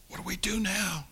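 a whispered, worried question; imitating a nervous whisper. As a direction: whisper it like a nervous young man unsure what comes next. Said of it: "and he's nervous".

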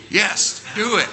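acted excited yes; eager approval. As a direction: urge it on with two punchy words. Boss eagerly approves prank plan.

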